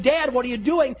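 urgent appeal to a father; pleading son. Acted. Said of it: "he's saying".

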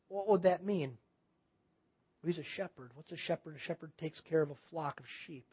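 hushed thinking aloud; quiet reflection. Acted. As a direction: ponder it softly, as if alone. Acting out reflection on the verse.

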